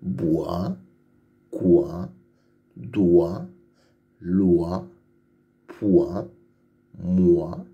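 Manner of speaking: each syllable from "Bois" to "voix" alone, followed by a pause; lecture de syllabes. Shown as a demonstration. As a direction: read "Bois", "quoi", "doigt" as isolated, drawn-out syllables. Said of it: "Lis les syllabes". (syllabes lues une à une, bien séparées, de « Bois » à « voix »)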